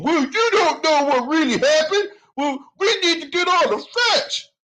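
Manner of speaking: high mocking imitation of a dumb commenter; mocking dimwit voice. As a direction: squeak it out like a clueless commenter. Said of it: "slow wit, dimwit, dunderhead, donut, dodo bird".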